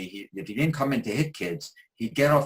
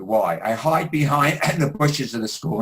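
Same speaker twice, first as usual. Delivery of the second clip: loud mock yell with laughter breaking in; imitated screaming; 4 semitones higher, 6 dB louder than usual. Angry boy confronts the therapist about his hiding, imitated scream. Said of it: "And he screams at me".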